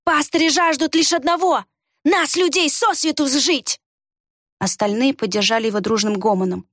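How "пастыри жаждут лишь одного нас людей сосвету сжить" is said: high, riled-up denunciation; venomous accusation. A narrator's reading. Villager rousing the crowd against pastors, venomous. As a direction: denounce them, pitched well up. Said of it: "ядовито прошипел Варх".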